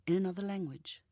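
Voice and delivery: plain reading voice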